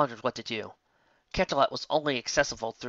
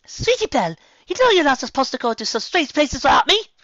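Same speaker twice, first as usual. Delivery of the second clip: much higher and louder, scolding; scolding yell; 10 dB louder than usual. A narrator's reading. Rarity shouting after Sweetie Belle, high and scolding. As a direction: call after her sister in alarm.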